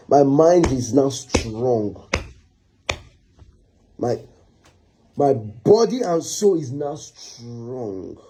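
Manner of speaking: slow, drawn-out declaration; hardened resolve. Acted. Husband refusing to pity wife, slow hardened resolve. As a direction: stretch the words out with heavy resolve.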